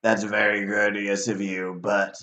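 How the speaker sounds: low-pitched, courteous chain devil; manly devil voice. Acted. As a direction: answer politely in a deep devil's voice. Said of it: "You have a very manly voice".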